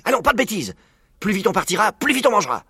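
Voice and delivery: high, brisk-spirited order; commanding. A narrator's reading. Father fox hurries cubs along to eat sooner, commanding. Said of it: "commanda-t-il".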